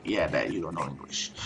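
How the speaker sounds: low, very soft dismissive remark; muted, low comeback to dad; low aside. Retold or imitated. Aside to dad about his English.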